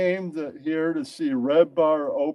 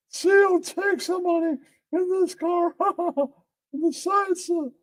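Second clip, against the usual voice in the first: giggly story narration; 14 semitones higher than usual. Acted. Storyteller laughing at own tale, amused narration.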